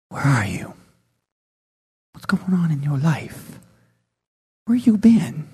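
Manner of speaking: low hushed calling voice, dropping to a whisper; voice of God calling. Acted. Preacher voicing God calling to a lost man, hushed and low.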